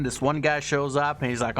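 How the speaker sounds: usual speaking voice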